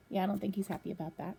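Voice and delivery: usual speaking voice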